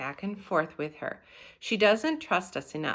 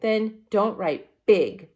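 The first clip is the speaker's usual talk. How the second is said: shouted; 4 semitones higher, 8 dB louder, slower than usual. Retold or imitated. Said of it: "Sheila shouted".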